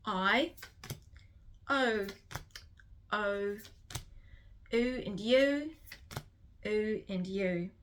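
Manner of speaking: usual speaking voice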